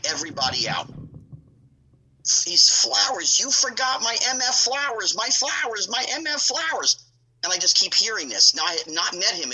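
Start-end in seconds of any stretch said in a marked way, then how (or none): 2.5-7.0 hammed-up tantrum over flowers; imitating a screaming boss. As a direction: rant about the forgotten flowers like a furious boss. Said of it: "just screaming and yelling".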